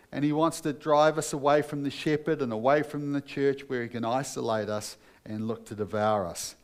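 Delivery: normal talking voice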